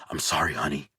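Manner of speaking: low, soft murmured sorry; tender muttered apology to daughter. A narrator's reading. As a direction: whisper it under your breath to her. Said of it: "Dave mutters".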